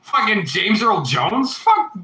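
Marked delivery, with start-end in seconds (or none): 1.6-2.0 sudden high yelp of disbelief; shocked outburst. Real, not acted. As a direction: blurt it out stunned. Shocked reaction to chat.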